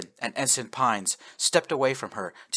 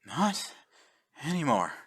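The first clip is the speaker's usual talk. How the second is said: sighing; 5 semitones higher, 3 dB quieter than usual. Acted. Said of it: "Admiral Garnett sighed".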